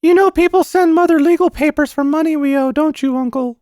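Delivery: put-on very high child voice, troubled; earnest little boy. Boy confiding in uncle about Mother, high and earnest. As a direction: confide as a worried small boy.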